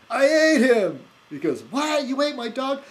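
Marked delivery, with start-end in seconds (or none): 0.1-1.0 high, loud mock-Scottish shout; Willie. Groundskeeper answering the boy about his dog, hammed-up Scottish yell. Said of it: "like Willie on, uh, The Simpsons". 1.6-2.8 high-pitched, shocked cartoon boy; Bart Simpson. Boy horrified about his dog, imitated high cartoon shock. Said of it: "Bart gives his dog away".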